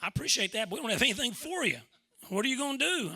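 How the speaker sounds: lightly voiced boss, a bit pressing; quoting the athletic director. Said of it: "And he said".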